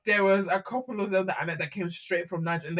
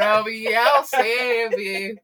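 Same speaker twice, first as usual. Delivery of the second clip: put-on country accent; 7 dB louder, much slower than usual. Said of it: "get that country accent".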